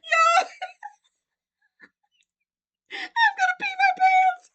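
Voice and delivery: helpless high, loud laughing shriek; laughing hard. Real, not acted. Vlogger opening a funny gift from a friend, laughing hard. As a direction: barely get the words out through laughter. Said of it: "I'm laughing so hard".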